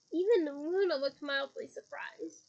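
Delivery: ordinary delivery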